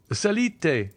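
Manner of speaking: high, sharp call down to the street; commanding order. A narrator's reading. The Count ordering the messenger upstairs, commanding. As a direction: bark it like an order to a servant. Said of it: "in the same tone in which he would have given an order to his servant".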